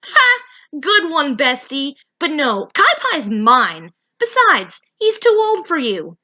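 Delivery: high, loud cocky voice; confident. Acted. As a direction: brush her off with a bright laugh. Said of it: "Kiki said confidently".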